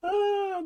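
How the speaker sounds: high giggle into a sigh; high laugh. Real, not acted. Still amused by Maryland, a high giggle. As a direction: laugh high, then breathe out. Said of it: "that's so funny".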